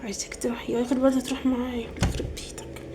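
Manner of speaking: very soft, low cursing under the breath; muttered grumbling aside. Acted. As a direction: grumble so the caller cannot hear. Secret grumbling during phone call. (برطمة بصوت واطي بعيد عن السماعة)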